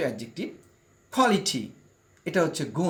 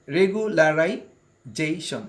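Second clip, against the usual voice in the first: Regularisation drawn out very slowly, each syllable clear; deliberate teacher pronunciation of regularisation; very slow demo; 3 dB louder, much slower than usual. Shown as a demonstration. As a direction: stretch regularisation out for the class. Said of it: "এখানে আমরা আরেকবার উচ্চারণ করে নিই".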